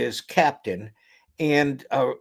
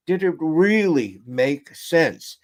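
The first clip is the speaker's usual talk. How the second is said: leaning slowly on REALLY; pressing question; slower than usual. Retold or imitated. Captain presses his lieutenant for honesty, slow probing question.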